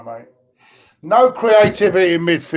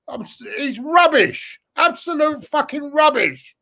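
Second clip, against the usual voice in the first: angry; 6 semitones higher than usual. Real, not acted. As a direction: drag it out, then spit the verdict. Furious about Mustafi.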